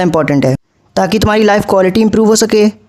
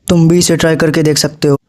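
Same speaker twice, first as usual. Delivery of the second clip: ordinary talk, nothing marked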